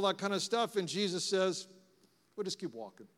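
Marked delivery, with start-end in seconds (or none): 2.4-3.1 very low, soft understatement; calm reply. Acted. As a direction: let it drop quietly, unbothered by the fuss. Voices Jesus's calm reply to confrontation.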